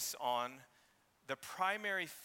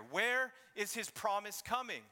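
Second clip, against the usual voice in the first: brooding sarcasm; 7 semitones higher than usual. Shown as a demonstration. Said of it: "This is a brooding type of sarcasm".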